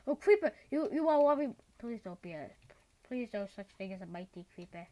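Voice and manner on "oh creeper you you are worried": alarmed. Real, not acted. Creeper appears.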